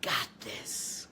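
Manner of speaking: whispered affirmation on the in-breath; whispered mantra. Shown as a demonstration. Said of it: "on the in-breath say".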